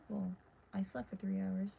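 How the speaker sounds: normal talking voice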